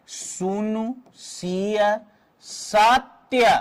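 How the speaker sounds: very slow, each syllable drawn out. Shown as a demonstration. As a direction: sound out the verse beat by beat. Teacher sounds out verse by matras. (एक-एक मात्रा खींचकर, धीरे-धीरे)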